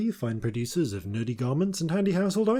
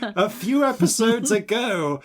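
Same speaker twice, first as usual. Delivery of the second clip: higher, louder creaky elder; old man voice; 7 semitones higher, 5 dB louder than usual. Acted. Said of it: "the voice I used for Far Future [name]".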